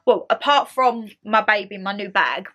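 normal talking voice

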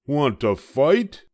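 high, loud, grumpy little bug; a tiny bug spoiling for a fight, high and loud; grouchy ladybug. A narrator's reading. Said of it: "said the grouchy ladybug".

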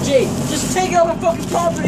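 high, heated demand; exasperated outburst, pitch jumping up; getting mad. Real, not acted. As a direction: angrily order a friend off with it. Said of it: "why you getting mad though".

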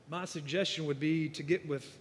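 ordinary talk

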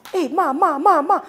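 high, loud cry for mother; retold shouting. Woman suddenly losing sight calls her mother, retold shouting. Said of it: "I was shouting".

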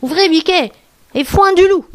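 a hint of a false motherly voice; imitating the goat. A narrator's reading. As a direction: call out as a wolf mimicking a goat. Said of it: "en imitant la voix de la chèvre".

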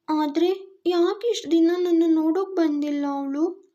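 put-on high, puzzled child's voice; a small child asking why mother never came; innocent little girl. Daughter asking her father, high and innocent.

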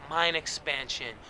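ordinary talk, nothing marked

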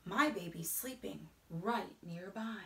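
ordinary delivery

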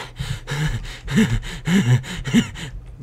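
long, high stuttering creepy laugh; creepy giggle. Pretend. Player pretending to hear voices, creepy giggling. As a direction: giggle on and on like someone unhinged. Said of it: "that's really creepy".

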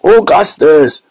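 long, fatherly shout; Mister Gloop. A narrator's reading. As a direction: call slowly after your son. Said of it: "cried Mister Gloop".

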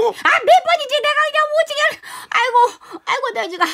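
high, fast comic despair; an old woman sure she is ruined; wailing grandma. Acted. Grandma despairs, forgets bus number. (과장되게 울부짖는 할머니)

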